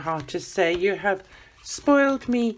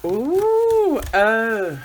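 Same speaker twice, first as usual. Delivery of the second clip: thrilled ooh; excited ooh; 2 semitones higher, 7 dB louder than usual. Real, not acted. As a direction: squeal with real joy. Opening a surprise parcel.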